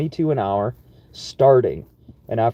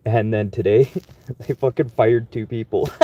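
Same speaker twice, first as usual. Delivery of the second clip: usual speaking voice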